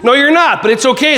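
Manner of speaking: ordinary talk, nothing marked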